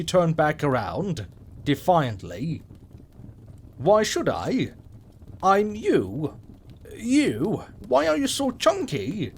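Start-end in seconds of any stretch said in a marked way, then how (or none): 3.8-9.3 put-on rising high, appalled little girl; horrified child. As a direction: recoil in childish horror. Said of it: "she stated in horror".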